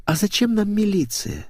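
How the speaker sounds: high-pitched languid question. A narrator's reading. Blonde languidly asks why involve police, languid. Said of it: "вдруг томно произнесла Светик".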